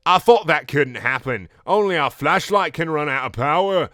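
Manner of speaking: hammed-up British character voice; Garnet's put-on British accent. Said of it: "what I'm doing anymore for Garnet".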